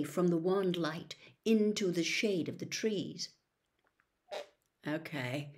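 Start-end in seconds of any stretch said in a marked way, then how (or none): 4.9-5.5 soft, defeated "Okay"; a quiet sigh of giving in; resigned sigh. A narrator's reading. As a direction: breathe it out, resigned to the worst. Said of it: "Ron sighed as though resigned to the worst".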